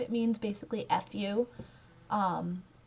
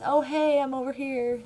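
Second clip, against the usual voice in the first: a friendly call across a room, raised and higher; mock hail to a friend, bright and raised; 5 semitones higher, 3 dB louder than usual. Calling out to a friend.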